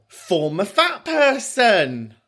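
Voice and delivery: high, slow, drawn-out discovery; dawning realisation. Real, not acted. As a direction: stretch it out high as the penny drops. Discovers what FFP stands for.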